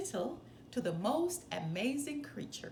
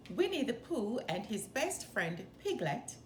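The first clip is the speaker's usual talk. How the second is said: usual speaking voice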